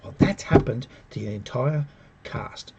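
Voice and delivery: normal talking voice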